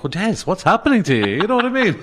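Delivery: high-pitched jokey impression of a friend; mini impression. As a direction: do a quick high-voiced take-off, laughing. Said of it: "that was a mini impression".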